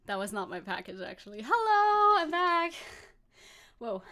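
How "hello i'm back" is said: excited hello. Real, not acted. Streamer returning to chat.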